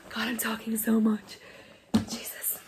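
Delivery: whispered, exasperated aside to herself. Real, not acted. Vlogger catching herself talking too much, whispered aside. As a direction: whisper it under your breath, to yourself.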